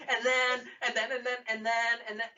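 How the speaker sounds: mock excited kid. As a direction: imitate a breathless six-year-old storyteller. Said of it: "a six-year-old telling you a story".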